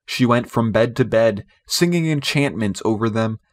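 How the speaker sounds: ordinary delivery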